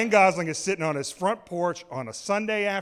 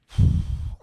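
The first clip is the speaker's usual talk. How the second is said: loud, breathy exhale; heavy sigh; 8 dB louder than usual. Acted. Comic giving up after his fiance's verdict, sighing heavily. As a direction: let out a big resigned sigh. Resigned sigh before the rueful ending.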